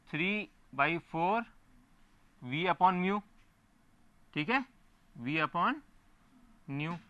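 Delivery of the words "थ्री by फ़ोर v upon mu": slow dictation. Shown as a demonstration. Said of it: "teacher dictating a formula".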